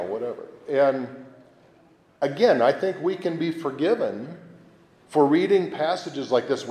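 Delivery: usual speaking voice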